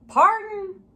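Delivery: can't believe what he heard; startled pardon. A narrator's reading. Sheriff stunned by answer.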